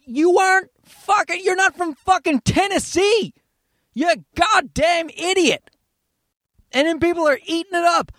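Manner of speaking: high-pitched, indignant outburst; exasperated rant. Real, not acted. Rants at the fake country singer.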